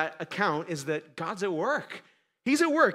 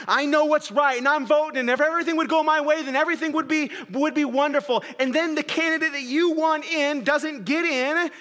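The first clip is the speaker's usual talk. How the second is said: higher and louder, building hope; eager voter; 8 semitones higher, 5 dB louder than usual. Retold or imitated. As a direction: sound sure everything will go your way. Voicing a voter's hopes.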